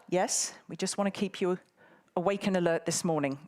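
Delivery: usual speaking voice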